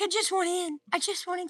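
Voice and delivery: put-on high, babyish voice for a pleading dog; dog's voice. Owner voicing her dog wanting in, high pet voice.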